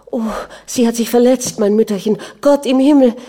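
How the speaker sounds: high, rising cry of horror; alarmed outcry. A narrator's reading. Nanny finds her injured mistress, crying out. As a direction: cry out in shock over her. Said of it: "schrie auf".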